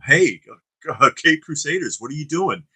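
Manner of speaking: celebrity cameo voice. Retold or imitated. Celebrity guest pops out window.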